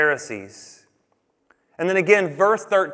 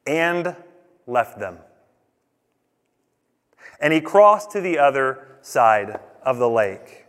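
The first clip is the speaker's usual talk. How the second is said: left them dropped low and slow after a long pause; slow weighty reading; 4 semitones lower, slower than usual. Shown as a demonstration. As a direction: land left them heavy and final. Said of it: "three words here".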